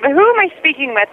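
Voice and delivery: normal talking voice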